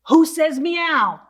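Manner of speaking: mock astonished, for the children; hammed-up shock at the cat; gasping surprise. Reader acts startled at the cat.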